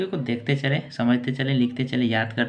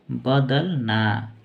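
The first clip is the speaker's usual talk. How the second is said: slow dictation; much slower than usual. Shown as a demonstration. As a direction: stretch the word out very slowly. Teacher dictating meaning to write. (बहुत धीरे, लिखवाते हुए)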